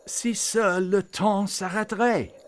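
high, loud plea in French; heartfelt wish in French. A narrator's reading. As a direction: cry the French out with all your heart. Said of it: "what Klaus actually said was".

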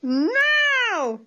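high loud shout. Acted. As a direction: yell the signal to jump. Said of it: "he yelled".

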